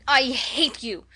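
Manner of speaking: slow, loud, choked-up outburst; choked cry. Acted. Orphan girl to the woman who mocked her looks, choked and furious. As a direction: force it out through a tight throat. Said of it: "she cried in a choked voice".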